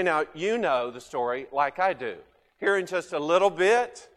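normal talking voice

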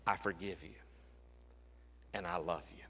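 soft, low and intimate; a hushed, loving reassurance; tender. Acted. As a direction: say it gently to one listener. Jesus speaking to congregation, softly.

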